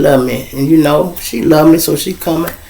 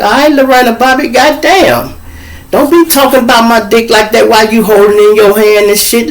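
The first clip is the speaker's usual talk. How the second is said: high, exasperated protest; indignant retort; 7 semitones higher than usual. Retold or imitated. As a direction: push back, high and fed up.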